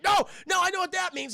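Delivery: high, frantic acted plea; panicked shouting. Hagen realises the thugs will hurt him, panicked shout. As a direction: shout in mock terror, voice way up.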